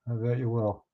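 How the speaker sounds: usual speaking voice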